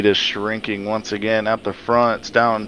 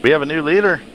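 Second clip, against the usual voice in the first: excited; 6 semitones higher, 4 dB louder, faster than usual. Real, not acted. Leader crashes, new leader.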